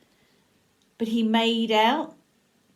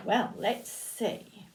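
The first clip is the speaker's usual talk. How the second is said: plain reading voice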